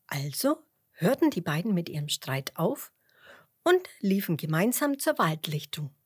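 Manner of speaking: plain reading voice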